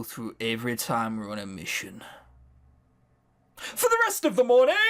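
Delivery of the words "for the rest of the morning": high, loud dramatic reading; mock dramatic. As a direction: announce it like a trailer.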